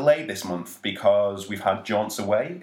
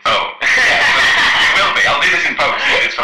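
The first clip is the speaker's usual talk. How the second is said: loud, much higher robot voice; a laughing, glitchy robot; 8 semitones higher, 13 dB louder than usual. Pretend. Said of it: "And we're back to normal".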